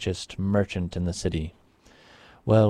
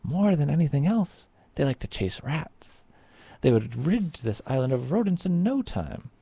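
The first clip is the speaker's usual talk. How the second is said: much higher, bubbling with enthusiasm; excited explaining; 9 semitones higher than usual. A narrator's reading. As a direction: explain it eagerly, way up high. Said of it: "said Antonio".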